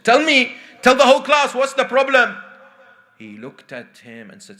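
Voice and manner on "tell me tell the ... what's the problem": raised, high-pitched insistent demand; an exasperated teacher calling a pupil out. Acted. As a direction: demand it loudly in front of a class. Acted teacher demanding an answer.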